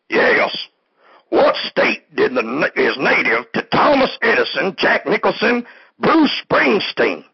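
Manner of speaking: high, eager quiz caller voice; second fake caller. Second fake caller quizzes the host, high eager voice. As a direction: squeak out a trivia question as an eager caller. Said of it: "Okay, next caller".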